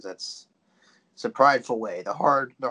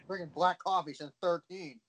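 higher, fast mock-heroic buddy voice; lightly hammed-up eager soldier boast; mock Tanner; 8 semitones higher, faster than usual. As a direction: ham up a proud recruit ready for the draft. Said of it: "He'd be like".

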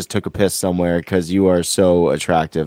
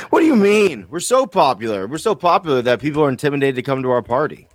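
high-pitched mock outrage; mock indignation; 6 semitones higher than usual. Co-host jokingly defends the show's popularity, mock indignant. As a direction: protest in a high, hurried, playful voice. Mock protest at being called unpopular.

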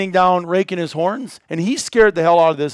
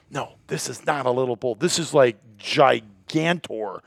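softer, lower hushed awe; hushed retelling; 5 semitones lower, 5 dB quieter than usual. Retold or imitated. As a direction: keep it hushed so the bull won't hear. Whispering in the hunt blind.

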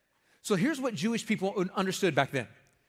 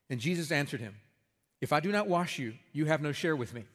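lower, measured Bible reading; scripture reading; 3 semitones lower than usual. Acted. Reads scripture passage aloud.

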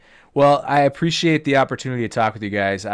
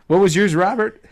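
high, still amazed; amused surprise; 8 semitones higher, 5 dB louder than usual. Real, not acted. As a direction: ask through the surprise. Amazed follow-up question.

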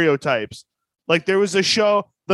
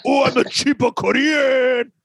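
shouted, higher fake Korean accent; mock Korean accent; 7 semitones higher than usual. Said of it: "He's like".

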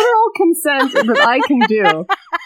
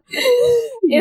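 usual speaking voice

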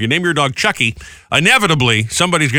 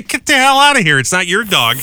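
mock angry retort, louder and higher; mock indignant; 9 semitones higher, 4 dB louder than usual. Host imagining telling off the stranger, mock angry. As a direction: snap back at the rude lady. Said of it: "I would've".

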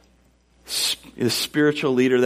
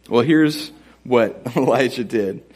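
ordinary talk, nothing marked

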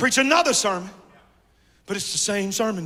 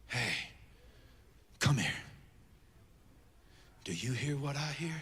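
hushed, secretive; 10 semitones lower, 18 dB quieter than usual. Acted. Prophet beckons after Mount Carmel, hushed and secretive. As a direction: call them close in a near whisper. Prophet confides what he hears.

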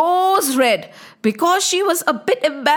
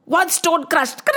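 ordinary delivery

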